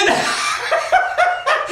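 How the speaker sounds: very high gloating cackle; Brer Fox's laugh. Acted. Fox laughs at the stuck rabbit, high cackle. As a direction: cackle high at a trapped victim. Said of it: "then he starts to laugh".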